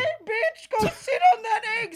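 high, loud mock yell with a laugh; impression of a friend yelling. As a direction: replay a friend's insult, hammed up. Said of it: "that's a good impression".

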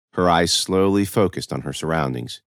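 plain reading voice

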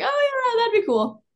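very high, breezy mock-agreement; clueless agreeing. Clueless, eager agreement, retold.

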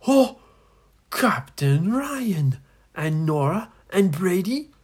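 high, loud gasp of surprise; hammed-up astonished captain; startled recognition. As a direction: cry out the names in disbelief. Crying captain looks up, startled.

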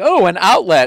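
high, loud excited exclamation; mock delighted discovery. Toddler spots something dangerous among safe toys, excited. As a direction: act a toddler thrilled by a find.

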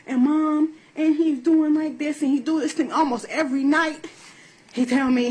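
a hint of the young son's voice; lightly voiced child. Mother quotes young son.

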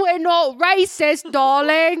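slow, drawn-out fake British accent; hammed-up British accent, playing along; mock British accent. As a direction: copy a British royal, laying it on thick. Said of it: "a complete wrong accent".

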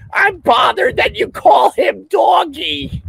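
hammed-up squeaky complaint; mocking a viewer. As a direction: whine it like an offended nitpicker.

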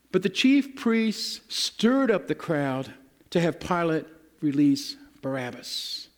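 normal talking voice

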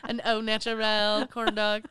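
loud, slow, laughing punchline; laughing joke. Pretend. Joking with her co-host, loud laughing punchline. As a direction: play it up, laughing. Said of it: "Here's a cattail".

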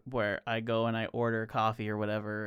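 ordinary talk

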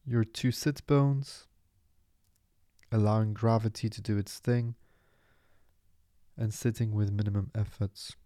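calm, unhurried meditation voice with long pauses; guided meditation. Acted. Meditation teacher settling listeners into their seat and breath, calm guiding voice. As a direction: ease the listener into stillness, phrase by phrase.

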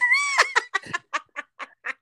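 loud, high burst of laughter. Real, not acted. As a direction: laugh at her own blunder. Laughing at own mistake.

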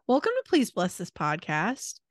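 ordinary talk, nothing marked

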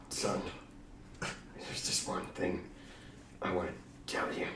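faint, breathy last words; dying whisper. Acted.

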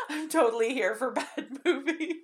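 high-pitched, laughing through the words. Real, not acted. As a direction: let laughter break into the confession. Amused confession about bad movies.

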